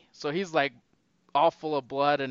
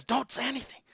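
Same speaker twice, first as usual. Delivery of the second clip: high, hushed acted aside; mock hushed warning; 7 semitones higher, 6 dB quieter, faster than usual. Billy secretly warning his friends, in a mock hush. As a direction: hush them, soft and high.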